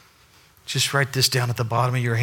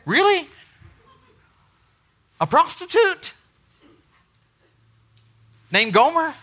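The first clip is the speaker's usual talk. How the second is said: much higher, astonished squeak; incredulous; 5 dB louder than usual. Retold or imitated. Hosea reacting to God, high incredulous. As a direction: sound utterly thrown. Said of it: "the response of Hosea".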